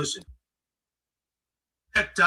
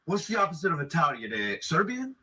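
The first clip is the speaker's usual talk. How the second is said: put-on Italian accent. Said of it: "What happened to your accent?".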